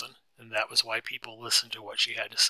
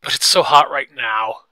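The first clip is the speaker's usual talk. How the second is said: much louder, distressed outburst; loud, worked-up protest; upset; 3 semitones higher, 15 dB louder than usual. A narrator's reading. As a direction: complain loudly, getting upset. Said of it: "getting more and more upset".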